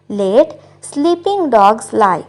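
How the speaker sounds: very slow, careful English model line; slow model sentence. Shown as a demonstration. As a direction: say each word slowly for learners. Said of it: "गड़े मुर्दे मत उखाड़ो". (धीरे-धीरे साफ़ बोला गया वाक्य)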